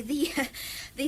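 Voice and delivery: usual speaking voice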